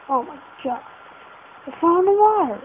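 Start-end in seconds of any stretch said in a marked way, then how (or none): 1.7-2.6 higher and louder, delighted; excited find. Real, not acted. Gamer thrilled at finding water, excited. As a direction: announce it with glee.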